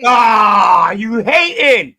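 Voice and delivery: loud, slow taunt; teasing shout. Real, not acted. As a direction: call him a hater, loud and drawn out.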